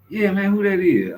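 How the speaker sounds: high, loud curious call; teasing high-voiced shout; hammed-up high question; mock homie. Homies calling out.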